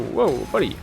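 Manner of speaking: ordinary delivery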